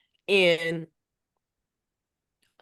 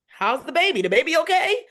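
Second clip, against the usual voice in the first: put-on higher, eager child asking; imitating her son; 9 semitones higher, 5 dB louder than usual. Mom voicing her son asking about the baby, higher and eager. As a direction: chirp it like a caring little boy. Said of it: "mom quoting son".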